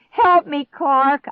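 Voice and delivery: very high, loud yell; desperate scream. A narrator's reading. Girl yanks frozen stepbrother, screaming. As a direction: scream for his help. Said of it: "I screamed".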